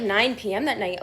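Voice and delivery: usual speaking voice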